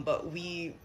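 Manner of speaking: normal talking voice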